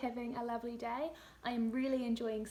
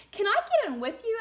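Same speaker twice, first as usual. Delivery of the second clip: put-on much higher, louder toddler asking; child's voice; 10 semitones higher, 10 dB louder than usual. The little one asking to join mum in the bath, high child's voice. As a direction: plead like a tiny child. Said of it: "asked the little one".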